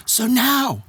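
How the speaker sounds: high, ringing announcer delivery; imitated stage introduction. Star introducing friend to the crowd, high announcer voice. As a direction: present a guest to a big crowd, pitched high.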